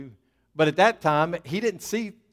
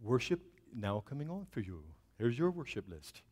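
low, soft mock assistant voice; virtual assistant; 5 semitones lower, 13 dB quieter than usual. Pastor plays the assistant announcing worship music, mock device voice. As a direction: imitate a smart speaker's measured reply, softly. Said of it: "give her a British accent and she'll say".

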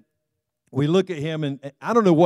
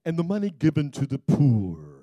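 lightly voiced, low and slow sanctimonious complainer; a hint of a whiny self-righteous critic; snooty Judas voice; 7 semitones lower, slower than usual. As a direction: Judas objecting to the perfume, a touch of prissy disdain. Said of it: "that's kind of the way he talked".

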